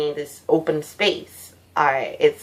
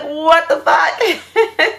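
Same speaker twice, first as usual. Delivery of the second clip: high, slow disbelieving laugh-yell; shocked laughing cry; 9 semitones higher, much slower than usual. Retold or imitated. Telling viewers how the dream monster attacked, shocked laughing cry. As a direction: blurt it out, stunned and laughing.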